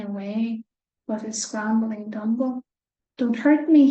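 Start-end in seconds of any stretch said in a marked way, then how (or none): 3.2-3.9 frightened plea, louder; whimpering. Acted. Scared lion begs the mouse not to hurt him, whimpering. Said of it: "he whimpered".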